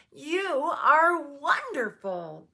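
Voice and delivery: loud, high, drawn-out praise; overjoyed, slow exclamation; gushing delight. A narrator's reading. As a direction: stretch each word with joyful emphasis. Delighted guest hugs the maid.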